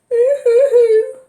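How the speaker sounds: high, drawn-out boo-hoo sob; child crying. Acted. Storyteller voicing a child crying boo-hoo. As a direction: wail it like a weeping child. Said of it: "A child was crying".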